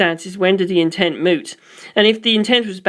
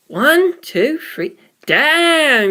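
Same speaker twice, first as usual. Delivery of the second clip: excited counting; 8 semitones higher, much slower than usual. Acted. Polka Loco counting the submarine fleet in awe. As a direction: burst up high in amazement.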